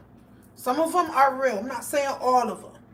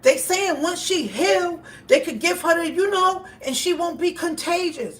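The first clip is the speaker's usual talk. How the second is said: exasperated pleading; 6 semitones higher, 5 dB louder than usual. Retold or imitated. Uncle arguing louder and higher with his stubborn nephew. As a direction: push back at him, exasperated. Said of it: "It's like".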